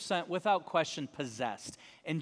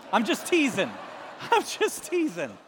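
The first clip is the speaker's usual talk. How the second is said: laughing; 4 semitones higher, 6 dB louder than usual. Real, not acted. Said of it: "laughing at own joke".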